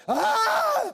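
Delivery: a sudden high loud yelp of panic; panicked yelp. Retold or imitated. Driver on the icy bridge yelps in panic. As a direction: imitate the friend's startled scream. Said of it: "He's like".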